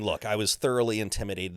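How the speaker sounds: ordinary delivery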